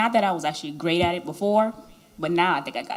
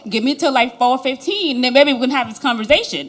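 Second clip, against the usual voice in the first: loud, higher-pitched protest; acted-out exasperation, pitched up and loud; exasperated pushback; 6 semitones higher, 9 dB louder than usual. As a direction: raise your voice, pleading for some breathing room. Acting out a mock quarrel reply.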